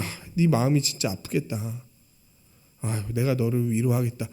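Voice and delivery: a hint of tender comfort; mild comforting. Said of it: "뭐 이렇게 얘기하셔도 되잖아요". (부드럽게 위로하듯)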